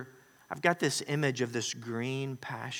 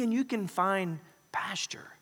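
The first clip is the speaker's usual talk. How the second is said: whisper; 4 semitones higher than usual. Acted. Quoting Jesus, hushed.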